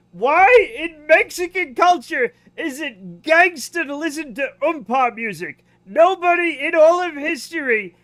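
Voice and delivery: high, loud, slow disbelief; loud incredulous rant about the music. Real, not acted.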